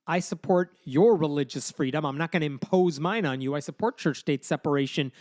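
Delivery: ordinary delivery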